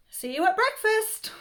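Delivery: high, loud cheeky parting shot; cheeky defiance. A narrator's reading. Child bidding the cabbage goodbye, cheeky.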